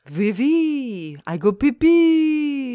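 put-on slow, high, silly French accent; mock French accent. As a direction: draw it out in hammed-up French. Continues silly French accent bit.

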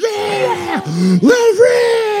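screaming cheer from the bleachers; cheering shout. Pretend. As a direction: yell it like a proud dad in the stands. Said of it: "I'm gonna be like".

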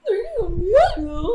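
scared Scooby through a laugh; goofy scared Scooby-Doo. Acted. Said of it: "hablar como Scooby-Doo".

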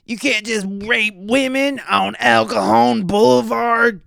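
slow high-pitched impression of an outraged street woman; imitating a street lady. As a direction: drag out the outrage like the alley lady. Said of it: "she's yelling this down the block".

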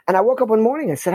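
ordinary talk, nothing marked

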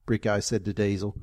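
ordinary delivery

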